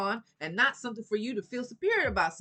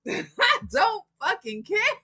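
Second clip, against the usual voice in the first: laughing dismissal; 12 semitones higher, 6 dB louder, much slower than usual. Real, not acted.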